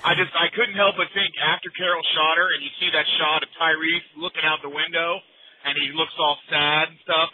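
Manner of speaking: ordinary talk, nothing marked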